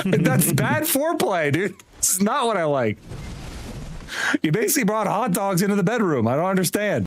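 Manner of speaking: high-pitched baffled complaint; mock indignation. Gamer joking to teammate about bad foreplay, high mock indignation.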